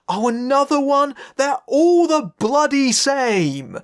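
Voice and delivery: high, exasperated mock complaint; exasperated grumble. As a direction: complain in hammed-up exasperation, dragging out the words. Acts out own weary reaction.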